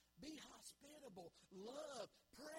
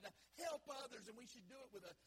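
normal talking voice

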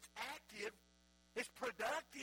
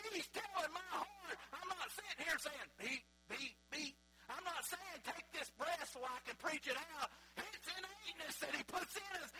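higher, louder, fervent proclamation; preaching at full force; 9 semitones higher, 6 dB louder than usual. Acted. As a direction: preach it out with fire.

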